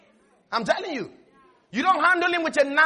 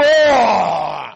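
loud startled gasp of oh; 6 semitones lower, 7 dB louder than usual. Acted. Preacher acting the scared listener, startled shout. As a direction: jump back in mock alarm.

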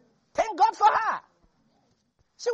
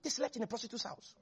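much softer, quick knowing murmur; quiet aside; 13 dB quieter, faster than usual. Shown as a demonstration. Preacher repeating the scandal to the congregation, hushed aside. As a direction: drop the voice to a confiding hush. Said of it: "repeated for emphasis".

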